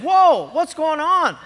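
startled whoa, drawn out; surprised. Retold or imitated. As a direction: caught off guard at an empty gate. Startled at empty gate.

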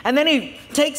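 ordinary talk, nothing marked

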